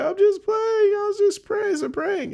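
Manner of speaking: high-pitched, wailing mock sob; crying girlfriend. Girlfriend confessing the prank to her boyfriend, mock crying. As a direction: whimper it out as a panicked girlfriend begs. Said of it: "And she was crying".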